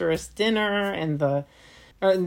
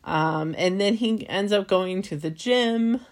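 ordinary talk, nothing marked

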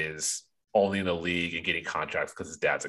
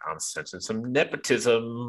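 higher, put-on commentator voice; Kalena; 4 semitones higher than usual. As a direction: imitate a co-commentator feeding into the dig. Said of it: "then you get Kalena going".

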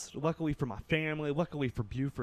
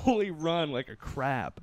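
ordinary talk, nothing marked

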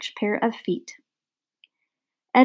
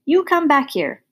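higher, restrained storyteller's shout; 6 semitones higher than usual. A narrator's reading. Ernest calls after the fleeing dog, a mild high shout. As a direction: call after a runaway, not at full volume. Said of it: "Ernest shouted".

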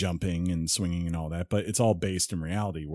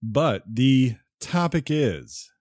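slow, drawn-out sultry announcement; sultry narrator; 5 semitones higher, 4 dB louder, much slower than usual. Pretend. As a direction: purr out the title slowly, teasing. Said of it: "in his sexy, sultry voice".

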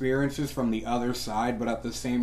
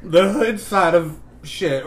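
laughing; 5 semitones higher, 7 dB louder, slower than usual. Real, not acted.

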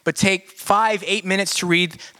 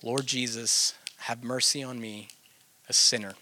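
soft, prayerful repetition; 5 semitones lower, 8 dB quieter than usual. Shown as a demonstration. Teacher showing how to sit with the repeated prayer, soft and low. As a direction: murmur it softly as if alone with God. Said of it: "you're just sitting there with that prayer".

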